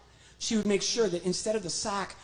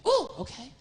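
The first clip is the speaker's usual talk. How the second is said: puppet amazed. Acted. Puppet gets eyes.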